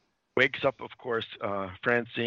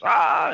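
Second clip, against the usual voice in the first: loud, high acted "ah"; mock cry of pain; 7 semitones higher, 8 dB louder than usual. Podcaster as Freddie landing on the floor, mock cry of pain. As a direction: yelp as you hit the floor.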